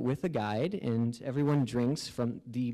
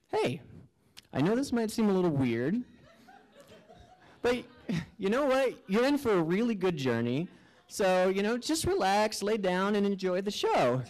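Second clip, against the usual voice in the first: higher, easygoing guide voice; friendly otherworldly presence; 6 semitones higher than usual. Acted. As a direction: coax a newcomer to relax, pitched up. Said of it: "then the presence starts communicating with me".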